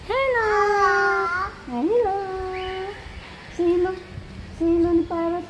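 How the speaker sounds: high pet-talk voice; coaxing a parrot. Real, not acted. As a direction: prompt the bird in a high voice.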